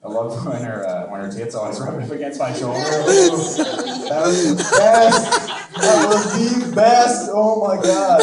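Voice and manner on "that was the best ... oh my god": high, loud and drawn out with delight; gleeful. Real, not acted. Recalling the hairdresser, gleefully loud and slow. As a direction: savour it like the best memory ever. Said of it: "joyous recall of hairdresser".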